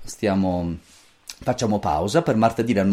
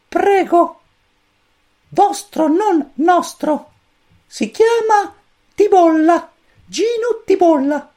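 very high, squeaky little chick voice; cosmic chick voice; 6 dB louder, slower than usual. A narrator's reading. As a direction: chirp it like a tiny alien chick. Pulcino cosmico risponde. (vocina acutissima da pulcino)

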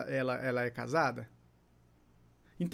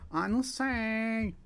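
higher, drawn-out wavering reply; indecisive tone; 5 semitones higher, much slower than usual. Shown as a demonstration. Teacher imitating an undecided person, slow indecisive tone. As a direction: drag it out as if unable to decide. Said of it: "o tom de voz de uma pessoa indecisa".

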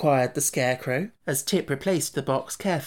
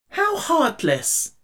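a slow, much higher gasp of shock; 13 semitones higher, 3 dB louder, much slower than usual. Acted. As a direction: recoil in horror at the idea. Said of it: "greatly shocked".

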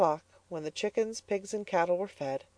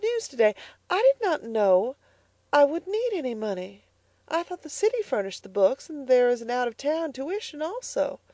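put-on much higher, pleading girlish voice; young Elnora; 10 semitones higher than usual. A narrator's reading. Elnora asking her mother for ten dollars, higher girlish voice. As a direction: ask timidly for help.

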